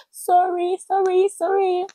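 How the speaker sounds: high, gentle imitation of a comforting pastor; soothing pastor. Pastor Ife hugging her, imitated in a high soothing voice. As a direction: hum it up high like soothing a child. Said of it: "She said".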